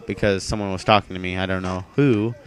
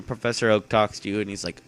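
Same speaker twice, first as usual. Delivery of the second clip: ordinary talk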